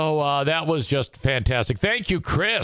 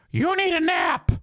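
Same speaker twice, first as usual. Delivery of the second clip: much higher, squeaky imitation of a woman friend; high mock woman voice; 13 semitones higher than usual. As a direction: parody the friend with a shrill-high take. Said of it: "She doesn't sound like Maureen".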